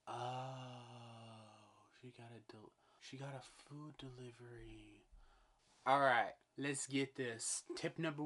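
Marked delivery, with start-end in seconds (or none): 0.1-5.0 hushed. Real, not acted. Hushed, neighbours at night.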